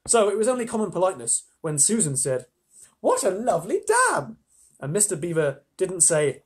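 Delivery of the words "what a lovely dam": high, drawn-out, admiring exclamation; gushing compliment. A narrator's reading. Susan politely praising Mister Beaver's dam, gushing. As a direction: gush over it slowly, high and loud.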